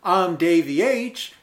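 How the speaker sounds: fake Southern accent. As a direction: play a Yankee faking a Southern accent. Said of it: "that fake Southern accent is tiring to do".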